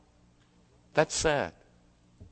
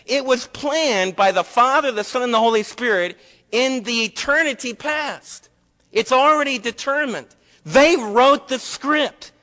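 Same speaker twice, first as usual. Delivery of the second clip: full-force preaching; 8 semitones higher, 5 dB louder than usual. Acted. Pastor proclaiming to the congregation that the plan was fixed, full-force preaching.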